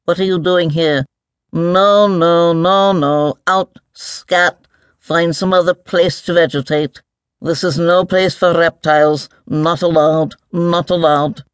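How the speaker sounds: lightly voiced fussy mole, taken aback; fussy creature voice, mildly put out; astonished mumbler. A narrator's reading. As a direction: shoo the intruders away in mild astonishment. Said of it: "the liquid voice mumbled in abrupt astonishment".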